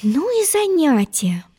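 put-on high, slow girl's musing; musing Alice. Alice stuck with sleeping queens, musing in a high voice. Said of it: "рассуждала Алиса".

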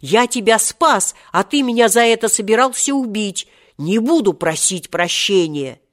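indignant, high and loud, easing on the refusal; hot-tempered retort. A narrator's reading. Seryozha accuses the one he saved, heated and indignant. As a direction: protest hotly, then refuse. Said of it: "запальчиво возразил Серёжа".

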